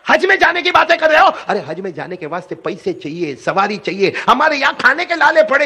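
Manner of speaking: an exasperated mother raising her voice at her son; angry mother. Retold or imitated. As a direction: scold the boy like a fed-up mother. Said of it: "ماں کا دماغ گرم ہو گیا".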